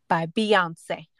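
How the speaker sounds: ordinary talk, nothing marked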